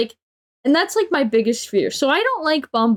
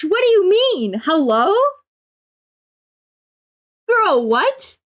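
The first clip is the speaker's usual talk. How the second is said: incredulous outrage; 8 semitones higher, slower than usual. Real, not acted. Venting about a coworker, high incredulous outrage. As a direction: throw the question back, stunned.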